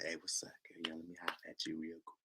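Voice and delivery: whispered, hurried come-on; mock inaudible pick-up murmur; talking low. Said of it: "speaking too low".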